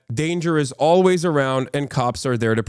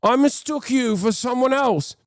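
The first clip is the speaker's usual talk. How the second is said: much higher, flustered apology; higher panicky guard apologizing; groveling guard; 11 semitones higher than usual. Retold or imitated. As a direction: cringe and backpedal to a superior. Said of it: "the guards will be like".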